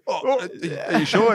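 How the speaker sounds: high, puzzled waiter voice rising at the end; mock confused waiter. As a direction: play the baffled waiter, up high.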